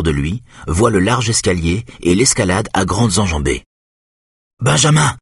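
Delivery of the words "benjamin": appel fort vers l'étage; crie. A narrator's reading. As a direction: crier le prénom, voix haute. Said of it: "crie-t-il en s'arrêtant". (crier « Benjamin » fort et haut)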